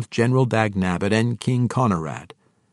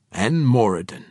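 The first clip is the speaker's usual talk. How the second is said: plain reading voice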